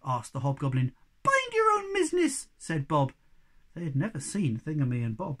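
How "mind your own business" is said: much higher, louder tiny defiant voice; squeaky little creature. Acted. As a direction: pipe up like a tiny brave creature.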